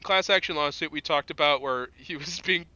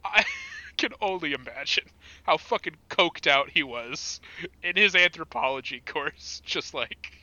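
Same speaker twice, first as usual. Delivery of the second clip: ordinary delivery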